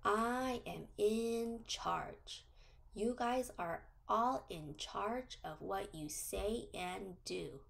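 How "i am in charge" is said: very slow, each word spaced out; slow affirmation for kids to repeat. Shown as a demonstration. Teacher leads children in a slow affirmation. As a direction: lay it out word by word for the class to echo. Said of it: "And say".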